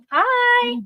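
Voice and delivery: high, chirpy hello; sugary service hi; cheerful hi. Shown as a demonstration. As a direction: squeak a bright hi. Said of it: "naturally cheerful".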